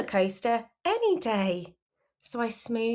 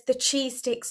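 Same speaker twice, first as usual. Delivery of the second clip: ordinary delivery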